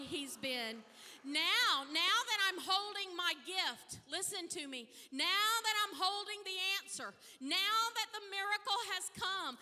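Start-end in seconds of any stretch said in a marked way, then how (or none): 1.2-9.6 higher, louder preaching cadence; preaching at full force. Acted. Pastor testifying to the congregation, full-force preaching. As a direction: build the praise like a preacher.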